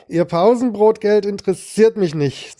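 brusque. A narrator's reading. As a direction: drunk hitman curtly brushes off the victim's money offer, brusque. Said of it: "sagte ich schroff".

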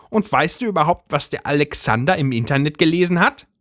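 high agitated housewife voice; Brigitte's voice. A narrator's reading. Brigitte rants.

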